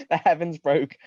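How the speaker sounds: plain reading voice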